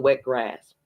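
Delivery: usual speaking voice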